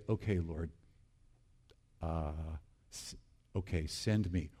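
low, hushed, halting prayer; hesitant surrender. Acted. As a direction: quietly give in to God, haltingly.

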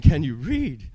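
loud demand. Retold or imitated. Huge inmate repeats the question.

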